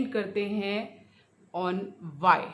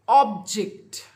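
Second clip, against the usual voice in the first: OB-ject, first syllable punched loud; noun stress; 8 dB louder than usual. Shown as a demonstration. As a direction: say object as a noun, hitting OB. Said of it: "first syllable".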